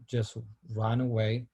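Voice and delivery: ordinary talk, nothing marked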